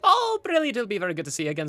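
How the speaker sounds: very high, loud gush easing down; delighted innkeeper. Acted. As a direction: gush happily at a favourite customer. Innkeeper delighted by a returning customer.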